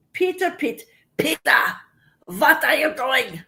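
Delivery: imitating a German mother, lightly accented; light German accent, calling a child; mom's German accent. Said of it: "My mom used to talk like that".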